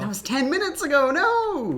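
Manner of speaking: fast, high cry of dismay; dismayed wail. Real, not acted. Player seeing ten minutes of play lost, dismayed wail. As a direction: wail it high as the progress slips away.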